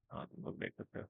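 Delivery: playful whispered self-answer. Pretend. Teacher answering his own question to viewers, whispered. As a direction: breathe it, no voice at all.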